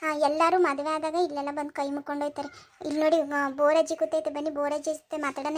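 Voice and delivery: normal talking voice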